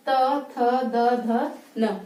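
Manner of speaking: त थ द ध न stretched out very slowly; slow teaching repetition; 1 very slow dental repeat. Shown as a demonstration. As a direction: repeat them again, drawn out. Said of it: "बोल के देखिए".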